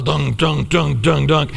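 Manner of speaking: thump out each lurching step; stumbling footsteps. Shown as a demonstration. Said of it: "slow motion stumble".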